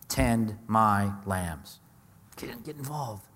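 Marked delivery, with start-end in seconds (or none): 0.1-1.8 each word drawn out very slowly; measured Jesus voice, every word stretched; slow, weighty command. Acted. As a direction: say it slowly and deliberately, like a gentle command. Jesus' reply to Peter.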